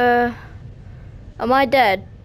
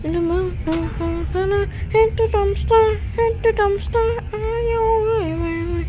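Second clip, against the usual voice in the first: high, slow nervous chant; nervous sing-song; 9 semitones higher, slower than usual. Real, not acted. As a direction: chant it to calm your nerves. High nervous chant while hiding.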